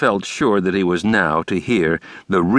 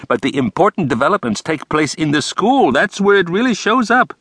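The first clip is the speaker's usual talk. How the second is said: higher, fussy theatrical principal; Brooks's voice; 7 semitones higher than usual. A narrator's reading. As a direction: lay it out with flair. Brooks advising on politics.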